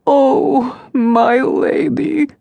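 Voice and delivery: high, crying wail, slowing on my lady; sobbing. Acted. Servant Varka sobbing to her condemned mistress. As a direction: sob out her grief. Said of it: "Varka bent her head and sobbed".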